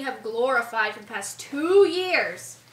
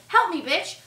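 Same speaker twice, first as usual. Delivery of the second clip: higher, louder cheeky demand; mock pushy demand; 4 semitones higher, 5 dB louder than usual. Fan imagining a bolder Rey pestering Luke, mock pushy demand. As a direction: demand help with hammed-up sass. Acts out demand she didn't make.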